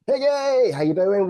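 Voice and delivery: bright high call of her name; light up as you greet her; a delighted hello to a guest; excited greeting. Real, not acted. Greeting a guest warmly on Zoom.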